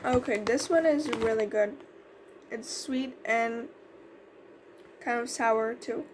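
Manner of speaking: eating snack between words; talking while chewing. Real, not acted. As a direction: mouth partly full. Seen 0: Ate a piece at -3s, holds snack stick, lips working while talking.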